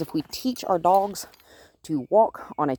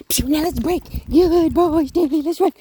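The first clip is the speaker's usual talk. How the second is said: running; 10 semitones higher, 5 dB louder than usual. Real, not acted. Running with dog, high excited.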